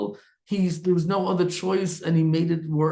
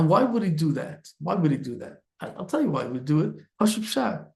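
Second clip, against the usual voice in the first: ordinary delivery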